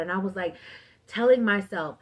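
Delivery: ordinary talk, nothing marked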